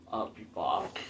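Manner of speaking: put-on low, quiet Austrian-accent action hero; Arnold Schwarzenegger accent. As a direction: drop low into a rough attempt at an Austrian accent. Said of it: "the Arnold accent".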